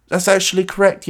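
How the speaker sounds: high mock-British voice; put-on British accent. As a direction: confirm it as the high British character. Closing the accented dialogue bit.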